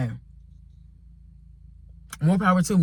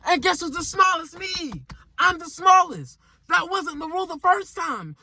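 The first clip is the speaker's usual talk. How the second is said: exasperated shout. Real, not acted. Complaining about sitting on floor.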